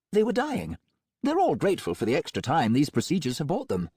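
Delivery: breezy, untroubled villain doctor; Melmoth voice. A narrator's reading. Melmoth justifies.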